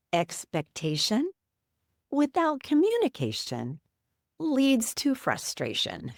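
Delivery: slow, drawn-out words with a grin in the voice; grinning slow saying. Retold or imitated. Husband grinning through the window screen at his wife, slow proverb delivery. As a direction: deliver it slowly like a proud little proverb. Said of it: "He gets a big smile on his face".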